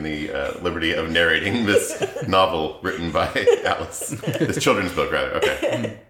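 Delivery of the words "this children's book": normal talking voice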